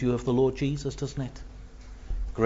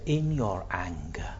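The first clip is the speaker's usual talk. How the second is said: hushed warning; 9 semitones lower, 10 dB quieter than usual. Acted. David quotes psalm quietly.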